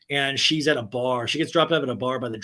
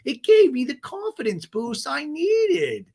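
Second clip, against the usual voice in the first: put-on much higher girly voice, slowing on sauce; sing-song it high, drag the confession out; high, breathless Gina; 13 semitones higher, 4 dB louder than usual. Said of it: "she goes".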